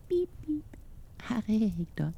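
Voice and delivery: high squeaky peeps from hiding; tiny thumb-sized boy, pitched high; small high voice, hard to make out; tiny, indistinct voice. A narrator's reading. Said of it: "for det låt så utydelig".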